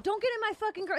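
high-pitched retold warning; protective boyfriend. Boyfriend warning after being spit on, protective boyfriend. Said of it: "He's being a normal boyfriend".

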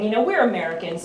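ordinary talk

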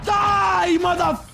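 high, drawn-out shout at an enemy; battle yell. Real, not acted. Gamer finishing off an enemy, battle yell. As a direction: yell it at the player you are killing.

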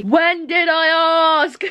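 high, slow, drawn-out complaint; mock exasperated retort. Vlogger retorts to Michael's whittering, mock exasperated. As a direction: stretch out each word up high.

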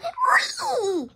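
high-pitched mock "wee"; imitated "wee" squeal. Viewer imitating a character, high squeal. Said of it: "Go like".

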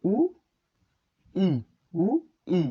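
উ, pause, ই, each vowel on its own; split vowel pronunciation. Shown as a demonstration. Teacher drills a compound letter, উ and ই sounded apart. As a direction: sound out উ and ই slowly, one by one. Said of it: "এই বর্ণের উচ্চারণ উ".

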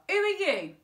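high, loud challenge; indignant demand. A narrator's reading. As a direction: demand it indignantly from men blocking your path. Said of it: "demanded Flip".

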